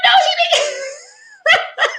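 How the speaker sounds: very high, drawn-out squeal of disbelief; retold disbelief. Host recalling her reaction to wigs in the seatbelt, shrieking disbelief. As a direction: shriek it, breaking into laughter. Retold shocked outburst at wig story.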